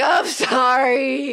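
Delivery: high, slow drawn-out sorry; mock apology. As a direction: stretch out a hammed-up apology. Acting guilty Olivia apologizing.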